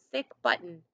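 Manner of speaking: plain reading voice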